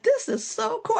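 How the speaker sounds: very high-pitched, overwhelmed delight; retold giddy excitement. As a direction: gush in a high voice about a dream job. Retelling being overwhelmed by her job.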